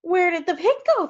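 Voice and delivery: high, alarmed question; flustered outcry; animals crying out. A narrator's reading. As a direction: call it out in bewilderment. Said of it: "the animals cried".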